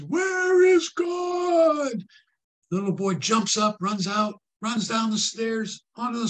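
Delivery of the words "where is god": very high, loud and very slow; booming minister voice that scares the boy; big booming voice. Acted. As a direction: thunder it out like a preacher. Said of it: "in a big booming voice".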